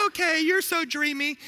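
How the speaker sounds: put-on high, swooning girlfriend voice; Nala. Girl cub giving in to Simba, high and smitten. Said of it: "And then she goes".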